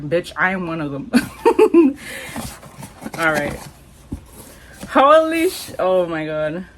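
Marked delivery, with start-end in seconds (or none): 1.1-3.1 a high, airy burst of laughter; high breathy laugh. Real, not acted. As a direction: let the giggle escape up high. Laughing at her own joke.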